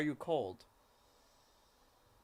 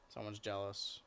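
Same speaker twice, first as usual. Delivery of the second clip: ordinary delivery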